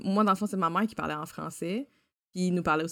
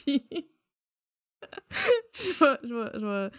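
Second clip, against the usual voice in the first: laughing; 10 semitones higher than usual. Real, not acted. Laughs at switching languages.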